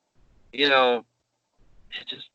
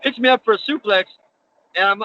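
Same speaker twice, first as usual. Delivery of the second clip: usual speaking voice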